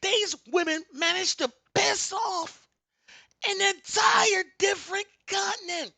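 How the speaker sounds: very high, loud shouted preaching; booming preaching. Real, not acted. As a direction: build it to a shouting climax. Preaching rant climax.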